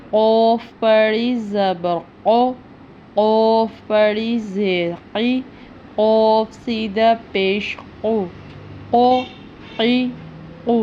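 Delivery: throaty heavy ق; heavy qaf. Shown as a demonstration. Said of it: "حلق سے ادا کیا جاتا ہے، پر پڑھا جاتا ہے".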